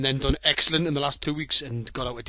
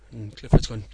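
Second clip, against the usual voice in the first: ordinary talk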